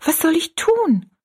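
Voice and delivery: put-on high, helpless girl's question; helpless dismay. Rosalie turns to Betty Ann for help, asking in helpless dismay. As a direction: ask in real distress. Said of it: "rief Rosalie ganz bestürzt aus".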